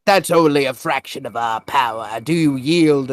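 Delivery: lightly voiced defiant penguin; a hint of a challenging cartoon warrior; penguin warrior impression. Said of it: "And they're like".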